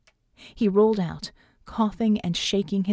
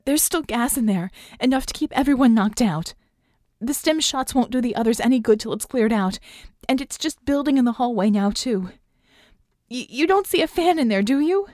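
higher-pitched, strained explanation; urgent, slightly hoarse; 4 semitones higher, 4 dB louder than usual. A narrator's reading. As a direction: press on through a tired throat. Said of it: "her voice sounding hoarse".

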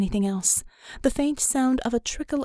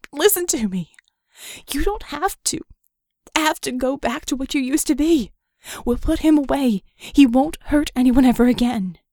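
louder, urgent begging, pushing harder each line; desperate pleading; 4 semitones higher, 6 dB louder than usual. Acted. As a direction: plead with him to come back to himself. Pleading with Red to drop knife.

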